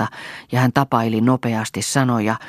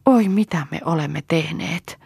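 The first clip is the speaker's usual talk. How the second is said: lightly acted tearful lament; understated sob, higher voice; sobbing; 5 semitones higher than usual. A narrator's reading. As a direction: lament in dismay, pitch raised. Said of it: "nyyhkytti Lea".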